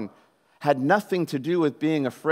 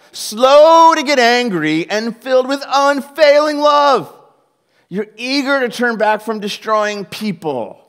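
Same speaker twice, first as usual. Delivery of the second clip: loud, higher, whining at God; bitter complaint; 6 semitones higher, 9 dB louder, slower than usual. Retold or imitated. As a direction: rant to the Lord in full frustration. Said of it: "Jonah complained to the Lord".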